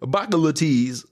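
put-on high, heavy Baltimore accent; exaggerated Baltimore accent; mock Baltimore accent. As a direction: imitate the Baltimore way of talking. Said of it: "Baltimore people talk".

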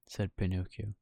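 plain reading voice

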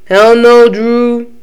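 put-on voice for his funny way of talking; imitating Fez. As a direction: mimic the man's refusal for laughs. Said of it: "the way this man talks is so funny".

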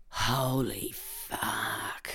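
slow, soft exclamation of shock; hushed exclamation. Acted. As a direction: draw each word out, half whispered. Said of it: "exclaimed Lindy".

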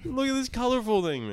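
high, loud imitation of an overkeen parent; mock excited parent. Mimicking a mother entertaining her kid in a store, high and exaggerated. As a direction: oversell it as if entertaining a toddler.

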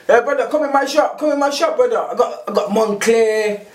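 mock Turkish accent.

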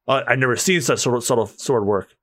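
low, composed character voice; deep swordsman. Acted. Onlooker admiring the sword work, deep and composed. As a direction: redo it in a deep voice.

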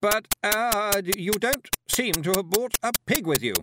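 high, flustered objection; hesitate, baffled, in a high hostess voice; loud, puzzled stammer; taken aback. A narrator's reading. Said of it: "taken aback".

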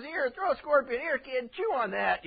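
tossing it off like a heartless father; cruel dad. Retold or imitated. Preacher voicing a cruel dad giving scorpions, loud and mocking.

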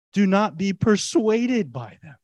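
ordinary talk

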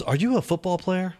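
retold question, pitched well up; curious nurse, imitated. She asks the heart patient about himself, imitated high curious voice. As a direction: imitate her, high and wondering. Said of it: "she goes".

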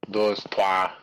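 ordinary talk, nothing marked

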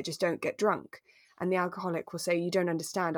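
ordinary delivery

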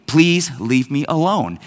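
slow, restrained calm voice; utterly calm. Retold or imitated. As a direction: mimic an unbothered, deliberately calm request. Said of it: "the most calm voice imaginable".

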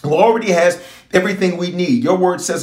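ordinary talk, nothing marked

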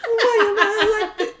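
high, loud laughing wail; laughing self-mockery. Real, not acted. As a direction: wail it helplessly through laughter. Laughing at own obsession.

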